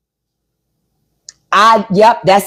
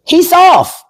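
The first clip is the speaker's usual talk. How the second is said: emphatic call-out; 9 semitones higher, 4 dB louder than usual. Real, not acted. Repeating his verdict, emphatic.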